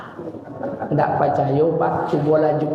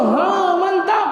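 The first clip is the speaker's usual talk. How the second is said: hearty approval. Acted. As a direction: shout your approval with gusto. Guru approves loudly.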